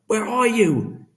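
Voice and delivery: high slow panicked cry; panicked call. A narrator's reading. Boy calling for his father in panic. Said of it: "He was seized by panic".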